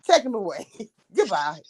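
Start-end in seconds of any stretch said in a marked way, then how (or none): none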